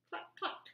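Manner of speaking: chicken cluck. Acted. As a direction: cluck like a hen. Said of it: "the chicken said".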